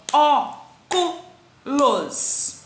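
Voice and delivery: ÓCULOS split into separate, firm syllables. Shown as a demonstration. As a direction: say óculos as ó, cu, los with pauses. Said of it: "Vamos separar". (óculos separado em sílabas, com pausas)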